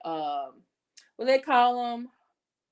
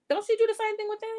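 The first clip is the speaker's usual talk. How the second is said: incredulous challenge; 11 semitones higher, much faster than usual. Real, not acted. Rhetorical challenge.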